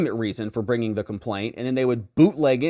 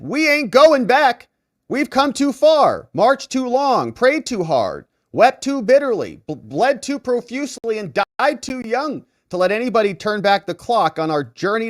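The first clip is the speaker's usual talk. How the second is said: quoting a preacher; 10 semitones higher, 5 dB louder than usual. Retold or imitated. Commentator voicing the reverend's speech, loud preaching. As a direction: thunder it like a civil rights sermon. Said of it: "He said".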